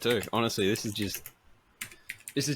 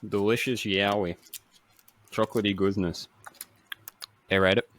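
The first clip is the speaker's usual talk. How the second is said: chewing while talking. Real, not acted. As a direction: mouth full of chocolate. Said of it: "while I'm eating".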